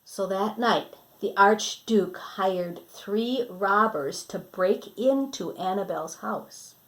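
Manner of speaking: lively storybook reading voice; reading to children. Acted. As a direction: read it brightly to the kids. Storybook narration.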